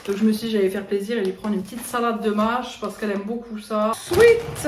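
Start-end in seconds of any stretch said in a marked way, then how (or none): 4.0-4.7 sudden high squeal. Real, not acted.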